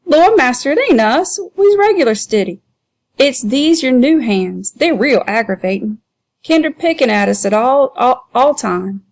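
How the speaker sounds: high, fawning dialect voice; butter up the master in a high whine; wheedling Sambo. Acted. Sambo blaming the new hands to the keeper, high and fawning.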